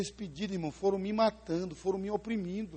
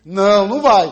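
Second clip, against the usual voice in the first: much louder, firm grandmother's no; stern refusal; 3 semitones higher, 18 dB louder than usual. Retold or imitated. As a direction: shut the boy down with a hard no. Grandmother forbids the boy.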